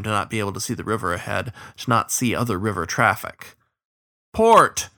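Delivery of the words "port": high, loud shout back; shouted reply. A narrator's reading. Tired rower answering the captain's order, shouted. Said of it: "Shen shouted back".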